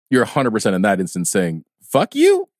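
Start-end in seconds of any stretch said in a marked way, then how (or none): 1.8-2.5 высокое громкое «Fuck you»; шутливо выкрикнуть; mock fuck you. Meaning of offended excuse me.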